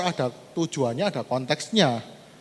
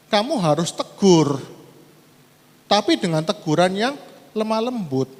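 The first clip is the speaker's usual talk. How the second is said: preaching at full force; 2 semitones higher, 5 dB louder than usual. Acted. Preacher reading Galatians aloud to the church, loud and forceful. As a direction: proclaim the verse to the congregation.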